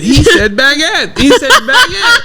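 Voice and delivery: high, drawn-out mock yell, laughing at the end; imitated heckler yelling. Comedian retells woman yelling from the back, mock yelling. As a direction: mimic a drunk heckler's repeated high yelling. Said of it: "she kept yelling it".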